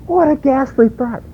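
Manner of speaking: high, mock-British exclamation; British accent. Preacher repeats Phillips in a high mock-British voice. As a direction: say it like a shocked Englishman. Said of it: "J B Phillips is British".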